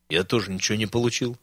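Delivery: soft and inward, barely letting it out; pensive mutter. Acted. Former factory owner grumbles to his friend, a quiet pensive mutter. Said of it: "задумчиво процедил бывший заводчик".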